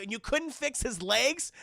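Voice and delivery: ordinary talk, nothing marked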